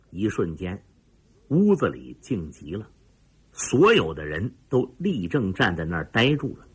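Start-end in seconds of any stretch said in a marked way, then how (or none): none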